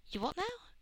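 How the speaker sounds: high, puzzled question; bemused. A narrator's reading. Rose hears officials chanting at her, bemused. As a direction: ask it baffled, pitch lifted. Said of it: "Rose asked in bemusement".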